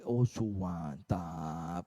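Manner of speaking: very low, long-drawn, soft murmur of admiration; savouring admirer. Acted. Someone admiring what they see, savouring it in a low drawl. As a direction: draw it out low and slow, savouring it.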